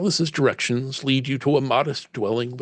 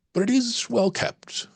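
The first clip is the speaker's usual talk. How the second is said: ordinary talk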